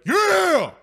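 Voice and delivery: loud, high sports-parent shout; sideline parent yell. Retold or imitated. Dad imitating himself yelling from the stands, loud and high. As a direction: cheer like the parent who freaks out at games. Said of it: "You know the parent that's, like, at the games that freaks out?".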